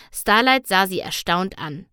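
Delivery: ordinary delivery, nothing marked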